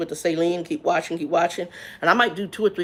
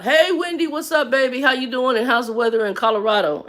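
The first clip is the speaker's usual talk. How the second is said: high, loud cheerful hello to a viewer; excited greeting; 6 semitones higher, 4 dB louder than usual. Real, not acted. Streamer greeting a chat viewer, bright and excited.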